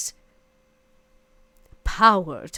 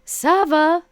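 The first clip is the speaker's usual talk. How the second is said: calling out into the dark, loud and high; a loud call into the shadows; shout; 9 semitones higher, 10 dB louder than usual. Acted. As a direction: yell his name across the distance. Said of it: "I shout out to the d-, dark distance".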